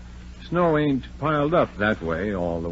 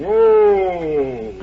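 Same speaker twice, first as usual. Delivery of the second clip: calling out; 11 semitones higher, 8 dB louder than usual. Acted. Driver halting horse in snow.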